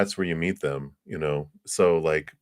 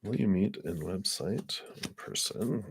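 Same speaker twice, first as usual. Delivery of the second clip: murmuring the question to herself, much softer; quiet murmur; 11 dB quieter than usual. Shown as a demonstration. Reader murmuring the question over her cards, quiet. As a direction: say it softly under the breath. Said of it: "Let's take a look here".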